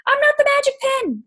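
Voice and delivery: high, sharp sassy retort; sassy comeback. Retold or imitated. To Alana who wants her to chart for her, high sassy comeback. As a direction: snap it back with attitude. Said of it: "I'm like".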